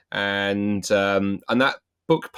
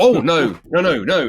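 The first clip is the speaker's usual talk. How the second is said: loud, higher alarmed "oh no, no, no"; alarmed denial; 5 semitones higher, 8 dB louder, slower than usual. Retold or imitated. Stranger recoils at being lumped with Hitler, loud alarmed denial. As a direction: back away fast from the idea.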